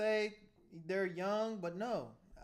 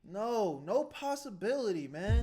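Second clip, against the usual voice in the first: louder, higher protest; 3 semitones higher, 6 dB louder than usual. Real, not acted. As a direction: push back hard against the judge. Objecting to parole sentence.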